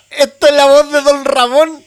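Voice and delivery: loud, slow words through laughter; laughing loudly. Real, not acted. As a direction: push the words out while laughing. (hablando fuerte entre risas)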